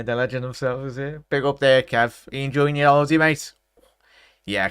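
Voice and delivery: normal talking voice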